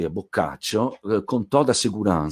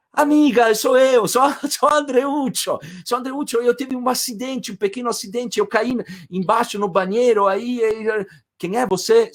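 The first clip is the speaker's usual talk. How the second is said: pleading call; 13 semitones higher than usual. Retold or imitated. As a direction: beg to be let in, explaining in a rush. Filthy Andreuccio pleading at door.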